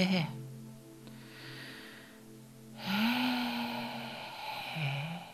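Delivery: long slow exhaling sighs; deep relaxing sighs. Shown as a demonstration. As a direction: let the breath go with a sigh. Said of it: "Zucht eerst maar eens even".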